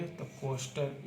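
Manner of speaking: soft, slowed coaster as it is written. Shown as a demonstration. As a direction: ease coaster out slowly and quietly. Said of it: "तो coaster क्या लिए".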